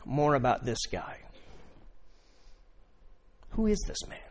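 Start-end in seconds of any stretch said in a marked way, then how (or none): 3.5-4.2 soft, wondering question; hushed musing; inward wonder. Acted. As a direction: ask it to yourself, hushed.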